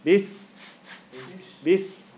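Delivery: "this" as "bis" with a very long hiss; snake-like s. Shown as a demonstration. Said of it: "like a snake is going".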